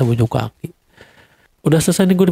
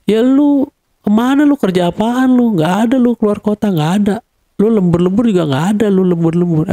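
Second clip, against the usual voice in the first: quoting his wife's yelling, pitched higher; wife yelling at husband, higher and sharper; mock angry wife; 6 semitones higher than usual. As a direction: play the furious wife, raised and accusing. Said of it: "sambil marah-marah, teriak-teriak".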